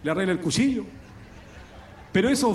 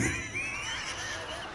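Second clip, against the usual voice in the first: carcajada aguda y fuerte; 12 dB quieter than usual. Real, not acted. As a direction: suelta una gran carcajada al contar el chiste. Remate del chiste, carcajada. (risa estridente al remate del chiste)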